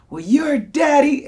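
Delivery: high, loud, slow jeer; mock drawn-out taunt. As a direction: stretch the insult like a goading kid. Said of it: "he paused and he was like".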